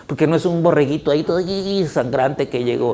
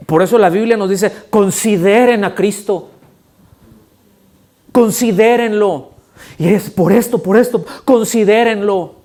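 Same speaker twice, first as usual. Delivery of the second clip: loud, higher, urgent preaching; preaching at full force; 5 semitones higher, 5 dB louder than usual. Acted. Preacher urging the congregation, loud and higher. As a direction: press the call home, loud.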